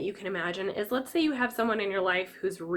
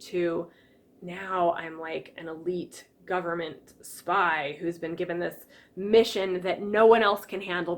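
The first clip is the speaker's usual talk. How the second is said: ordinary talk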